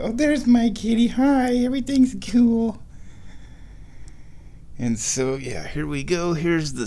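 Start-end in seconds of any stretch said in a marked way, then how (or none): 0.0-2.8 high cooing pet voice. Real, not acted. Owner greeting her cat in high baby talk. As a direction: coo reassurance at the cat.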